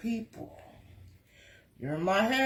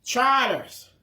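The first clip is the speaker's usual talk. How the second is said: ordinary delivery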